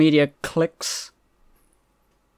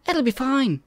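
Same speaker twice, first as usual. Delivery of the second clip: high character voice; 11 semitones higher than usual. Retold or imitated.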